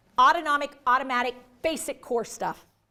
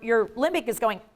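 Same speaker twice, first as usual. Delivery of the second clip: ordinary talk, nothing marked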